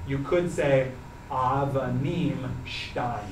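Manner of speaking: ordinary talk, nothing marked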